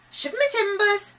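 high, loud parrot cry; loud squawking parrot. A narrator's reading. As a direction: screech it like a parrot. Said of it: "the voice repeated".

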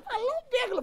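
high squealed insistence; imitated squeaky protest; stoned wife. Stoned wife still insisting on pergola, high mock squeal.

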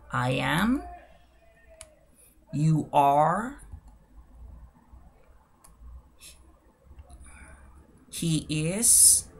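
separated model phrases with long pauses; slow demonstration. Shown as a demonstration. Teacher modelling the verb for learners, slow and deliberate. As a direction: pronounce each pair slowly and leave room to repeat. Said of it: "lo voy a hacer de manera lenta y despacio".

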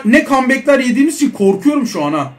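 normal talking voice

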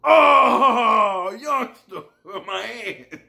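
high wailing cry of pain; pained old man wail. Retold or imitated. Narrator imitating the cuffed old man crying out in pain.

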